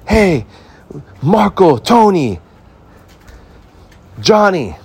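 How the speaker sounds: high, loud call to the crew; a boss ant yelling names to rally his ants. Retold or imitated. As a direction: summon the boys across the lot.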